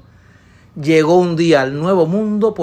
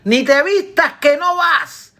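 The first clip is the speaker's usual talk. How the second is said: wife yelling after him across the harbour; shouting from the port; 10 semitones higher than usual. Acted. Wife shouts from the port at departing husband, high yell. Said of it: "le gritaba desde el puerto".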